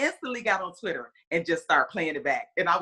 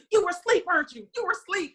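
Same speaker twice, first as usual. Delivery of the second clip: much higher, playful "caught you" tone; mock-scolding husband voice; teasing accusation; 12 semitones higher than usual. Husband teasing his wife for sleeping through the game, playful accusation. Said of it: "he was like".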